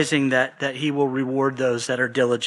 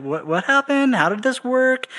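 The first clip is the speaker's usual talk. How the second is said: much higher, mock-whiny questioning; pestering leaders; 9 semitones higher than usual. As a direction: imitate them asking yet again. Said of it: "they've asked him this question".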